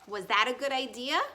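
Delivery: usual speaking voice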